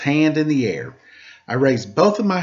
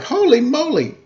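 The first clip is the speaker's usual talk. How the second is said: 1 excited; 11 semitones higher than usual. Acted. Excited exclamation.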